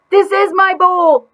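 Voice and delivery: high, loud, slow furious scream. Acted. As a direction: scream it, fed up with everyone. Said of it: "I screamed".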